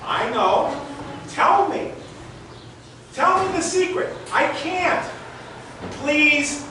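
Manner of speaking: put-on a kid nagging for the secret; pleading little boy. Boy begs Uncle Vic to reveal the trick, high pleading child voice.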